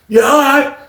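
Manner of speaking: high-pitched worked-up ranting; hammed-up seething inmate; acted threats.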